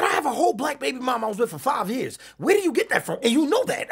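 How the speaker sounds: high, insistent protest; high-pitched, offended retort; indignant comeback. Retold or imitated. Indignant comeback retold to friend.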